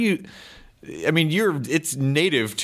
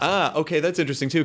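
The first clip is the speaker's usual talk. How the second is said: normal talking voice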